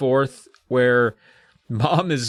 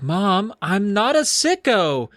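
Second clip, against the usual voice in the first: higher, drawn-out mock protest; protesting daughter; 7 semitones higher, slower than usual. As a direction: plead innocence to mom in a raised pitch. Acted quarrel, Amy to Mom.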